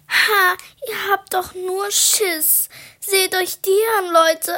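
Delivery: high, loud jeering through laughter; mocking shout. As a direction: taunt them, laughing at the cowards. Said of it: "schrie Lilly".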